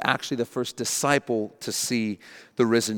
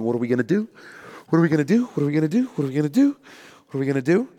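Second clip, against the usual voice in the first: nervous fretting; 2 semitones higher, much faster than usual. Acted. Disciples pacing in the locked room, nervous fretting. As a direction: mildly panicky repeated question, each rising at the end. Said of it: "pacing around nervously going".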